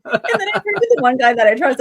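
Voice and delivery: can't stop laughing while telling. Real, not acted.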